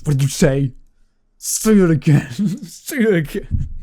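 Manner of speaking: a furious hero daring the villain; menacing challenge. Retold or imitated. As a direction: dare him to repeat it. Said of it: "Superman's like".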